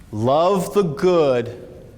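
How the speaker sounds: stretched out word by word; drawn-out emphatic phrasing; very slow emphasis. Shown as a demonstration. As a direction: say it very slowly so it sinks in. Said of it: "write this down".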